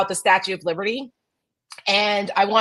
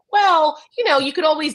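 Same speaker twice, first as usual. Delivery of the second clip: sarcastic; 8 semitones higher, 3 dB louder than usual. Real, not acted. Mocking tech bros' plans, a sarcastic well. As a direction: set up the grim joke with mock innocence. Sarcastic build to a grim punchline.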